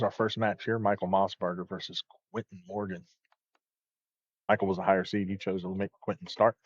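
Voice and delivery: steady bowling broadcast commentary; sports commentary. Acted. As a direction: call the match like a TV commentator.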